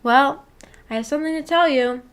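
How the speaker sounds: ordinary talk, nothing marked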